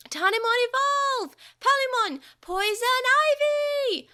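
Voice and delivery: loud, high creature shout, slow on the attack; battle cry. A narrator's reading. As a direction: announce the attack with force. Digimon calling evolution and attack.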